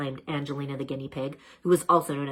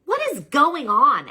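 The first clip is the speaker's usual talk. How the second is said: high, loud and a little slow; alarmed question; 11 semitones higher, 9 dB louder, slower than usual. A narrator's reading. Fish alarmed by the strange happenings, high and loud. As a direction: sound startled and urgent.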